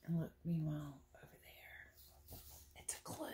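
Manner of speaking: hushed conspiratorial whisper. Acted. Narrator whispering to the kids about a clue. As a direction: whisper it like sharing a secret.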